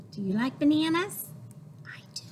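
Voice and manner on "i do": ordinary talk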